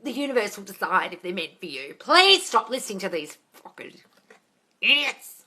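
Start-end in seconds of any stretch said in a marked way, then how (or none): 2.0-5.4 shouting. Real, not acted. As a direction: yell it out at them. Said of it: "while I shout".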